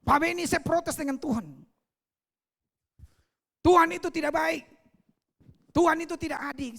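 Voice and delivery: a friend protesting loudly against God; loud aggrieved complaint; complaining friend. Retold or imitated. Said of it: "Lalu dia cerita begini".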